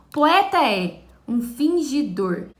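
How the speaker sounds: loud, slow reading of "Poeta é um fingidor" with vowels joined; slow scansion. Shown as a demonstration. Teacher scanning the verse for the class. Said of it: "scanning the verse aloud".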